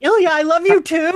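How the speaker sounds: high, loud lovestruck gush; gushing reply. Retold or imitated. Answering Ilya from the couch, high lovestruck gush. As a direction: swoon it out to the screen. Acting her own reaction to the scene.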